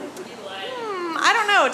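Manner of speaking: high, drawn-in hum of doubt; acted reluctance. Comic retells doubting her friend's matchmaking, acted reluctance. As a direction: play wary of the offer. Acts wary reply to setup.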